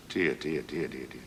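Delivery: very low, soft tutting; hushed, low commiseration; pitying mutter. Acted. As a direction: mutter it low under your breath. Pitying aside in pub joke.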